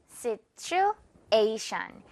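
Situation with A punched, stretched very slowly; stress on A. Shown as a demonstration. Teacher drilling stress on A, very slow. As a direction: hammer the A and drag it. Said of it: "A 부분에 강하게".

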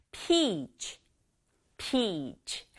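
"peach" with a long, drawn-out ee; careful model "peach" with lengthened vowel. Shown as a demonstration. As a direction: stretch the ee in "peach", then a light ch. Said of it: "이 하고 길게".

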